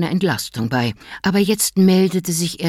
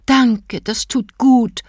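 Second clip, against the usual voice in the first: higher, slow relieved sigh of thanks; relieved wife; 6 semitones higher, much slower than usual. A narrator's reading. Wife thanking after gulping vodka, slow and higher. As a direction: savour the drink, higher.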